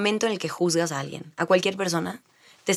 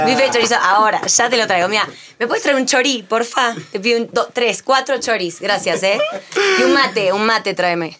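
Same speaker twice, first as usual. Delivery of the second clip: louder, higher porteño waiter voice; Argentine accent; 4 semitones higher, 4 dB louder than usual. Pretend. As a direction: order choris like a porteño. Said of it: "Acento argentino". (acento argentino, más fuerte)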